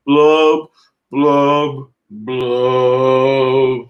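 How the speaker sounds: three loud, punched fish blubs. Acted. Reading the fish's refrain to her daughter, loud punchy blubs. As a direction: blurt each blub like a sulky fish.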